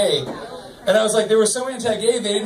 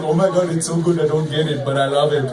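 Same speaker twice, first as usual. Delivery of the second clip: dopey voice; 5 semitones lower than usual. Acted. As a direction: voice him dumb and lovestruck. Character voiced in a joke.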